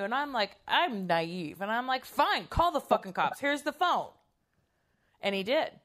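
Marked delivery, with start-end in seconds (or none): 2.1-4.1 high, exasperated retold comeback; acted defiant dare. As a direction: call the bluff, pitched up. Mother calls the child's bluff.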